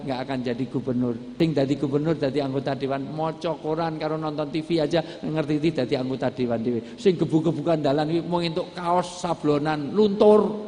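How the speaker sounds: usual speaking voice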